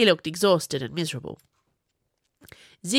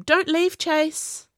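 calling out; 11 semitones higher, 5 dB louder, much slower than usual. A narrator's reading. Boy calling after brother.

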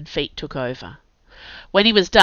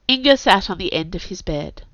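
ordinary delivery

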